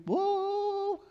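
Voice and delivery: high, put-on exclamation of amazement; mock surprise. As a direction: toss out a playful amazed whoa. Preacher reacting playfully.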